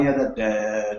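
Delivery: ordinary talk, nothing marked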